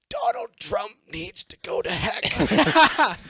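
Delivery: put-on very high, slow, laughing old lady; Nancy Pelosi impression. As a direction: stretch it out in a shrill granny voice. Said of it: "the ugliest face ever".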